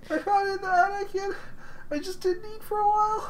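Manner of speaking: high, soft squeaky voice of a weird intruder; creepy intruder caught in a stolen shirt, squeaking softly. Acted. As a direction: play the odd stranger in a tiny high voice. Same intruder voice continues.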